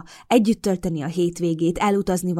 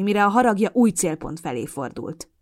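usual speaking voice

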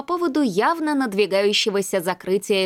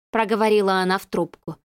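ordinary delivery, nothing marked

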